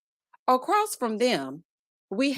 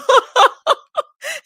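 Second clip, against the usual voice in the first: loud laugh; 10 dB louder than usual. Real, not acted. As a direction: burst of high, loud laughter. Host laughs at viewer's comment.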